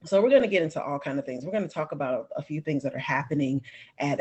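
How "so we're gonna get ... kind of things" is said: ordinary delivery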